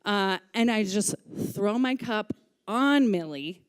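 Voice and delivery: normal talking voice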